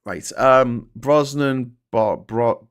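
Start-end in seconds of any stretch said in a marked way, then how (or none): none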